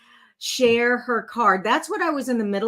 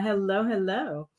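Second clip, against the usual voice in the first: ordinary delivery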